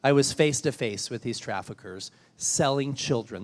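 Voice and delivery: ordinary talk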